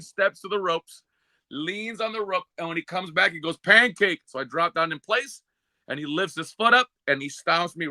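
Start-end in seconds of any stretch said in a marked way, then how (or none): 3.6-4.2 loud, higher one-word call; barked command. Retold or imitated. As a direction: bark the move name. Bubba calling the move.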